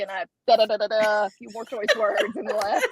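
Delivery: ordinary delivery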